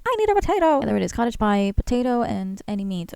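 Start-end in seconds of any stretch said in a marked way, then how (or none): 0.1-0.8 high-pitched mock plea; playfully squeaky retold demand; imitating own demand. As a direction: quote yourself in a high, needy voice. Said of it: "that's why I was like".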